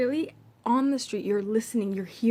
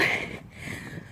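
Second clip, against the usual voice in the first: heavy exhale after running; real exhausted sigh; breathy sigh; 6 dB quieter than usual. Real, not acted. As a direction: let out a winded, shaken sigh. Catching breath after the chase.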